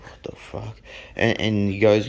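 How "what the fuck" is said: whispered disbelief; hushed, baffled aside; under the breath. Retold or imitated. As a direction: mutter it privately, almost voiceless. Muttered reaction to the mate.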